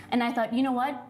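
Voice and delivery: ordinary talk, nothing marked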